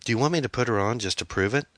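irritated teenage daughter, mildly voiced; a touch of teenage exasperation; indignant sputter. A narrator's reading. Daughter challenged by mother, mildly indignant. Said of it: "Amy sputtered".

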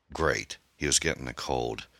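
ordinary delivery, nothing marked

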